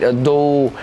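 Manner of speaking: ordinary talk, nothing marked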